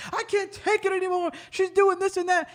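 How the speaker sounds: high whining complaint; whiny wife. Retold or imitated. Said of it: "she was like".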